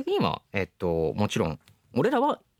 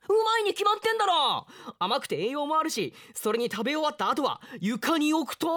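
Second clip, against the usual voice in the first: cocky insistence. Acted. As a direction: insist like it's obvious. Boy insists, bragging.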